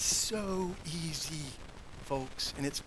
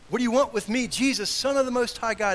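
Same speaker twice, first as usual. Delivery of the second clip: forceful, raised-pitch declaiming of the title; loud proclamation; 7 semitones higher, 7 dB louder than usual. Acted. Preacher re-voicing demon's words that sound like worship, loud proclamation. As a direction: declare it high and loud, like an acclamation. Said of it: "It almost sounds like worship".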